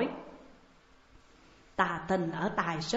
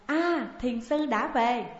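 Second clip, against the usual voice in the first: mildly excited higher call, drawn out; crying out; 5 semitones higher, slower than usual. A narrator's reading. Mai Nương announcing the master's return to everyone, crying out. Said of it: "Mai Nương la lên".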